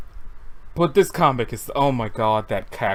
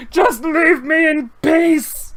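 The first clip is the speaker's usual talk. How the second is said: slow pleading cry; 8 semitones higher, 7 dB louder, much slower than usual. Acted.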